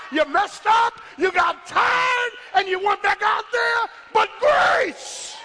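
drawn-out, shouted key words; full-force preaching. Real, not acted. Preacher reminding congregation how grace got them, full-force preaching. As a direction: punch and stretch each phrase like a revival preacher.

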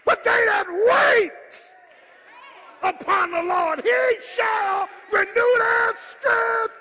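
loud, high, slow whooping climax; full-force preaching. Acted. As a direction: lift the church with every phrase.

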